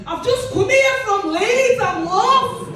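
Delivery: mock helpless tourist begging off the fare; playing the lost northerner. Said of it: "I just say".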